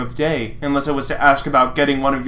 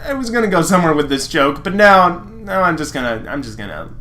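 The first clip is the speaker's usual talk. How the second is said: usual speaking voice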